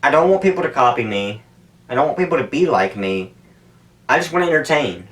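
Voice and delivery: normal talking voice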